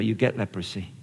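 ordinary talk

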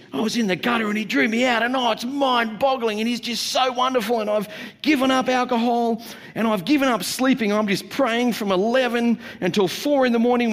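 overexcited believer raving about a diet; gushing zealot. Pretend. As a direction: rave breathlessly like a new zealot. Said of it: "the holy molies. And these guys are like".

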